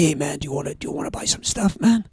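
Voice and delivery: retold stoner dealer in a quick, half-whispered American accent; lightly done American hippie accent, hushed and fast. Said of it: "transition between an American and Welsh accent".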